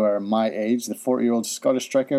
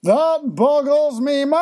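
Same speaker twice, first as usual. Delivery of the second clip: hammed-up segment title; 12 semitones higher, 3 dB louder than usual. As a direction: announce it big, stretching the last word. Announcing segment title theatrically.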